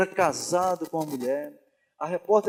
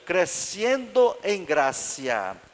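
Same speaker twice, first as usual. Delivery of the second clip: slow, imitated Spanish pronunciation; mock Spanish accent, slowly; much slower than usual. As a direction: say "crescendo em gracia" drawn out, as if in Spanish. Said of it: "É espanhol, crescendo em graça".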